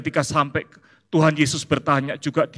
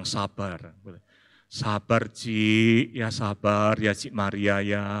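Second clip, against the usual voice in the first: soothing consolation; 5 semitones lower, 3 dB quieter, much slower than usual. Retold or imitated. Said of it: "Bu Bella, orang Palembang, bilang".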